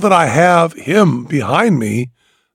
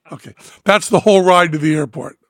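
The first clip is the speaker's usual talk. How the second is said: ordinary talk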